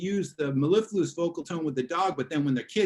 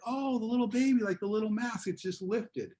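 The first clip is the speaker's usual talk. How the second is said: put-on much higher, cooing voice for a baby; baby talk; 7 semitones higher than usual. Shown as a demonstration. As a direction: coo at a little baby to make it smile. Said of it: "if you wanna make a baby smile".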